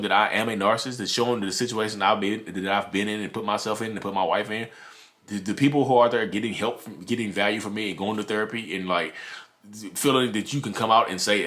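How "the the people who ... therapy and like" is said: normal talking voice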